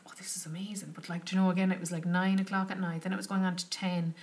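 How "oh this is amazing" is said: breathless whispered delight; hushed grateful aside; awed whisper. Retold or imitated. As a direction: whisper it like you can't believe your luck. Delighted private reaction to call.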